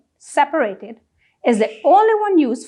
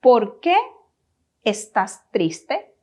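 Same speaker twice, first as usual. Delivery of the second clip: "¿Por qué ... estás triste?" in two slow parts; repeat-after-me phrasing. Shown as a demonstration. Teacher has learners repeat this question, slowly in parts. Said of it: "Try repeating this question with me".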